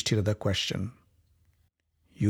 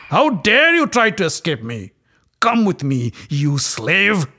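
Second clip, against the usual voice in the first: loud, higher angry shout; furious shout; 5 semitones higher, 5 dB louder than usual. Acted. Dussasana shouting at the fleeing queen, loud and furious. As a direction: shout as he seizes her. Dussasana grabs her hair.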